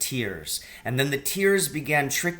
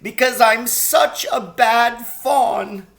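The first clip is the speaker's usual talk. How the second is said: sobbing; 10 semitones higher, 8 dB louder, slower than usual. A narrator's reading. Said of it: "sobbed Mister Tumnus".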